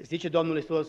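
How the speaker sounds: ordinary talk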